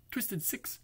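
plain reading voice

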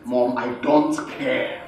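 low, slow, quiet refusal; controlled defiance. Acted. Son refusing his mother, controlled defiance. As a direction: hold "I don't care" down, low and deliberate.

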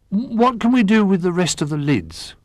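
high-pitched, wondering question; curious little bear. A narrator's reading. Little Bear asks about the spare lids, high and curious.

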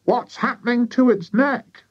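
high, querulous wife's voice; querulous farmer's wife. A narrator's reading. Wife staring at the cockerel, high alarmed question.

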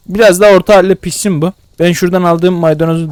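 ordinary delivery

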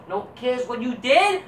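normal talking voice

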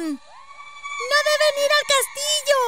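put-on very high, squeaky child's shout; shrieked warning from a small girl; little girl's cry. A narrator's reading. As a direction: cry out in alarm, high and drawn out. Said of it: "gritó una niña".

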